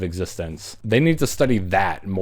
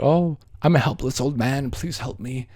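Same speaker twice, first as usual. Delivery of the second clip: hammed-up feeble old man, higher; helpless old man; 6 semitones higher than usual. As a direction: beg pathetically like a frail old hitchhiker. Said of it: "He's like, like".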